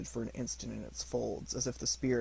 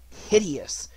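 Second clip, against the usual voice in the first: usual speaking voice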